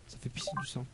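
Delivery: whispering. Real, not acted. Gory item effect aside.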